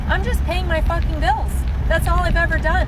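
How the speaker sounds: exasperated. Retold or imitated. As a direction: plead your case, high and fed up. Defending herself against money advice.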